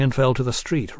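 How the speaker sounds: plain reading voice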